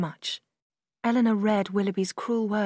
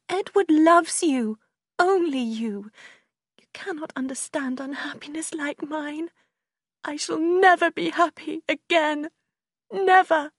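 high, anguished insistence, mostly loud; crying out; 8 semitones higher, 5 dB louder than usual. A narrator's reading. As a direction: wail in heartbroken despair. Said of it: "Marianne cried".

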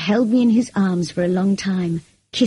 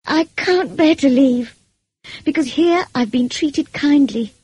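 higher, impassioned anguish; pouring out her heart; 6 semitones higher, 4 dB louder than usual. A narrator's reading. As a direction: plead it out, feeling overflowing. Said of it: "I poured out what was in my heart".

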